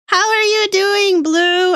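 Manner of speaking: high, gushing welcome; excited greeting. Real, not acted. Streamer checking on a newly arrived viewer, excited and high. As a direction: greet them in a high, delighted voice.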